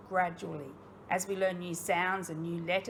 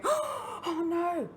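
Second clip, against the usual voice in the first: mock fright; 11 semitones higher than usual.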